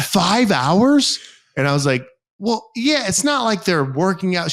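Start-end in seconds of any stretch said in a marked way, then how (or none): 0.0-1.2 high, loud disbelieving question; incredulous. Retold or imitated. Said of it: "She's like". 2.4-4.5 high defensive quote. Retold or imitated. Retold defensive reply to friend.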